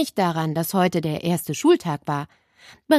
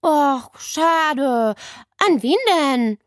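put-on high-pitched, let-down whine of a child; disappointed boy; 7 semitones higher, much slower than usual. A narrator's reading. Basti learns the knight's role is taken, disappointed. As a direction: ask it crestfallen, as a young boy. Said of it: "ließ enttäuscht sein eingebildetes".

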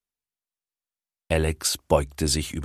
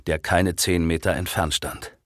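ordinary delivery

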